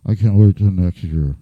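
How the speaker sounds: deep, loud excited fan cheer. Retold or imitated. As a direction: boom it out like a thrilled sports fan. Excited fan after the game.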